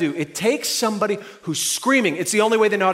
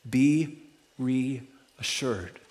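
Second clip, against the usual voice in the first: slow tender blessing; 6 semitones lower, 6 dB quieter, much slower than usual. Real, not acted. Closing reassurance, drawn out.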